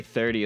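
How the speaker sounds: "thirty" said like dirty, hard d; "thirty" with an Irish t. Shown as a demonstration. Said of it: "I'm trying to say".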